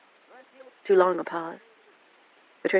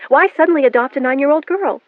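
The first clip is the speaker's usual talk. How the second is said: higher, louder urgent demand; 9 semitones higher, 8 dB louder than usual. A narrator's reading. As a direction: press her hard for the answer. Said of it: "Melanie's voice had gained urgency".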